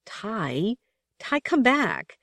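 rising, slow desperate plea; pleading. A narrator's reading. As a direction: beg him to come back, pitch climbing. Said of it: "Betten's voice in the intercom pleaded".

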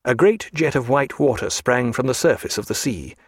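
plain reading voice

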